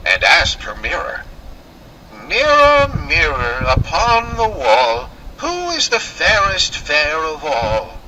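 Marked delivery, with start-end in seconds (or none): 2.1-8.0 wicked queen. Acted. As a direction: summon the mirror like a vain witch. Queen asks her magic mirror.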